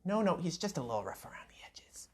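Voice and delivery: hushed, whispery parent making excuses; arguing parent. Acted. Mom and dad arguing about their son, hushed defensive parent. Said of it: "sounds like mom and dad arguing".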